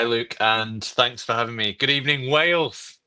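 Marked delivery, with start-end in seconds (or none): 1.7-3.0 playful, higher-pitched call to a whole nation; mock announcer greeting. Guest jokingly greets the Welsh podcast audience, mock announcer. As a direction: hail Wales like a contest presenter.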